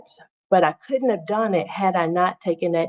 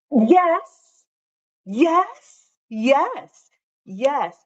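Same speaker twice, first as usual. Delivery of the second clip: excited; 9 semitones higher, 3 dB louder, much slower than usual. Real, not acted. Excited agreement with viewer.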